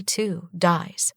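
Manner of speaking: ordinary delivery, nothing marked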